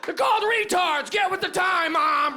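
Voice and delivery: shrieky, high-pitched telling-off; exasperated yelling. Retold or imitated. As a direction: imitate an outspoken daughter shouting down her mother. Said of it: "my mom would yell at her mom".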